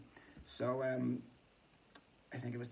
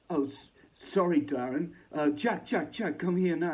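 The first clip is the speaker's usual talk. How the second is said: higher, louder dismissive put-on voice; mock rude interruption; 6 semitones higher, 11 dB louder than usual. [name] cutting off Barker to call Jack, mock loud. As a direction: brush him off and call Jack over.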